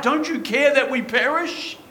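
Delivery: high, urgent retold plea; crying out. Preacher voicing frightened disciples to Jesus in the storm, crying out. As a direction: cry out like desperate disciples, pitched well up. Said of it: "They're gonna cry out".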